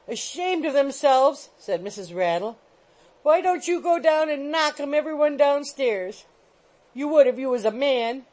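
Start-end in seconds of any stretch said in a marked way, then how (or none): 0.1-1.5 high, loud, drawn-out echo; shrill scorn. A narrator's reading. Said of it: "the shrill voice of Missus Rattle". 3.2-8.3 high, loud goading of a husband; shrill nagging. A narrator's reading. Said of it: "the shrill voice of Missus Rattle".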